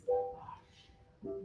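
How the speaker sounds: "Hush" very soft and low, tender; loving hush. Shown as a demonstration. Director showing the loving hush to the choir. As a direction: hush like someone who loves you. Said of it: "someone you love ... goes".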